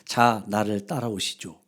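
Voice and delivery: low, slow, calm invitation from a stranger. A narrator's reading. Stranger invites him to follow.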